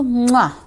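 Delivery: smack a kiss at the camera; blown kiss. Real, not acted. Blowing a kiss to sender.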